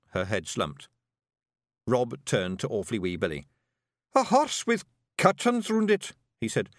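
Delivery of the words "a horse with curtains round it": high, loud wee Scottish fairy; rowdy little Scots pixie voice; broad Scottish accent. Acted. Rob speaks to Billy.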